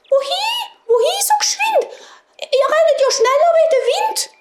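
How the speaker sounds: tiny, very high mouse voice; squeaking mice. A narrator's reading. Mice seeing the runners go past, high squeaky piping. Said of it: "haben angefangen zu piepsen".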